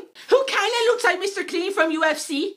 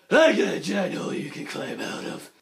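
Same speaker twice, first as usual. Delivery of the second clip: low, gruff Batman; mock Batman voice; Batman growl; 10 semitones lower, 4 dB quieter than usual. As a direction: growl like the hero. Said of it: "Bane is like".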